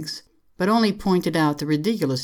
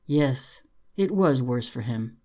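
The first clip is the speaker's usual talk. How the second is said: ordinary delivery, nothing marked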